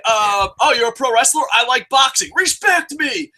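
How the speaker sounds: high shouting tough guy demanding respect; hammed-up fan shouting for respect; skit MMA fan. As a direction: yell respect me like a touchy fan. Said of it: "reenact my skit".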